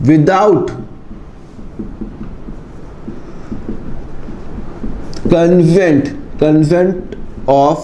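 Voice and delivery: very slow, word by word, as if dictating notes; slow dictation. Shown as a demonstration. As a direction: spell the rule out slowly so students can write it down. Teacher dictates rule slowly. (धीरे-धीरे लिखवाते हुए, शब्द-दर-शब्द)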